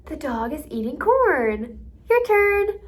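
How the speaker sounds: slow line with CORN high, then bright loud "your turn"; child-directed prompt, CORN swooping up; modeling for repetition. Shown as a demonstration. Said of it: "so it might look like this".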